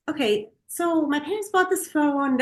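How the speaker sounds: imitating oneself explaining on the phone; retold phone explanation. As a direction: run through a practised explanation to customer service. Retold phone call to store.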